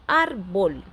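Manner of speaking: árbol split in two, weight on ár; stress on first syllable. Shown as a demonstration. Teacher gives an example word, árbol stressed on ár. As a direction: sound out árbol by syllables, leaning on ár. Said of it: "a sílaba tônica é a segunda".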